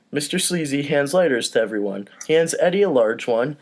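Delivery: ordinary talk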